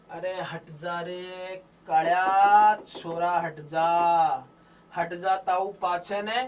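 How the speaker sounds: put-on drawn-out rustic Bagri, shooing a kid away; Bagri village speech. Shown as a demonstration. Teacher demos Bagri dialect to his students, slow rustic shooing. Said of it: "Bagri का मतलब बेटा ये देख ऐसा".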